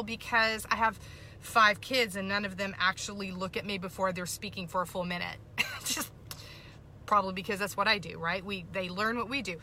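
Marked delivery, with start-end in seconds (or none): none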